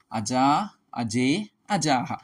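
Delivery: slow, deliberate chanting of forms; slow recitation. Shown as a demonstration. Teacher reciting Sanskrit noun forms to students, slowly. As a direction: spell out each form slowly for students.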